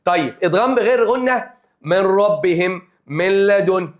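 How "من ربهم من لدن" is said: من ربهم slowly, nun merged into a doubled ر with no nasal hum; merged without nasal. Shown as a demonstration. Teacher to students: من ربهم, nun merged without ghunna. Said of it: "دي إدغام بغير غنة".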